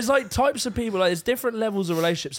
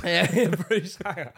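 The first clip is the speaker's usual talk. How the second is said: laughing; much slower than usual. Real, not acted. Joke name, laughing.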